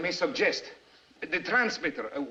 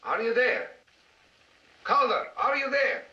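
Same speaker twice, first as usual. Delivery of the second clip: raised-pitch radio call; 7 semitones higher than usual. Acted. Radio operator hailing a silent contact, calling out. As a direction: call for someone who isn't replying.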